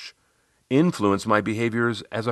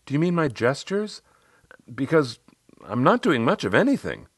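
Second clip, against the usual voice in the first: lightly voiced client, pitched higher; a hint of a questioning client, higher; Riley; 7 semitones higher than usual. Client asking her coach about gestures, lightly voiced higher. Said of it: "She said".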